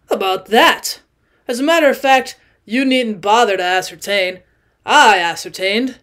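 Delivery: put-on loud, emphatic tipsy old man voice; a drunk guest insisting, too loudly; drunk owl-eyed man. Tipsy man to Jordan, loud and insistent.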